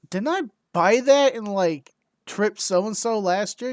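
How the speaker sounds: rising disbelief, high-pitched; incredulous question. Retold or imitated. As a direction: ask it like you can't believe it. Retold question to Jason.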